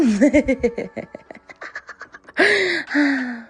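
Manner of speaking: drawn-out high laugh; amused lingering laugh; long giggle. Real, not acted. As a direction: let the laugh trail off. Laughing after so yeah.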